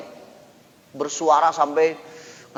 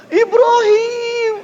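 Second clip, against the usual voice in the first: high wailing cry of grief; crying out a name; 9 dB louder, much slower than usual. Retold or imitated. Usama wailing over the dead child. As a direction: wail the name like a grieving mourner.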